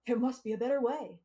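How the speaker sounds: big, punchy infomercial pitchman. Shown as a demonstration. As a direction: sell it like a late-night TV ad. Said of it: "Best infomercial voice".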